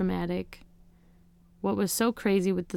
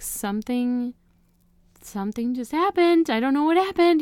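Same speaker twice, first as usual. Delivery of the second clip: rising panicked cry, much higher and louder; panicked screaming; 6 semitones higher, 7 dB louder than usual. Retold or imitated. Mother in labor crying out to the nurses, panicked. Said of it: "I just started screaming".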